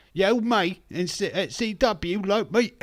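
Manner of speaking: loud, high Cockney wrestling promo; over-the-top Cockney accent; mock Cockney. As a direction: ham up a cartoon Cockney. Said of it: "what she's gonna talk like".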